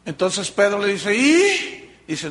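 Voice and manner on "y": sharp high surprised ¿Y?. Acted. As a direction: snap it up in disbelief. Pedro questions Jesus, surprised.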